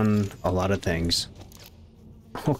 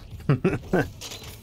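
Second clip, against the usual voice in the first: breathy laugh; 3 semitones higher, 4 dB quieter than usual. Real, not acted. Laughs at chaos.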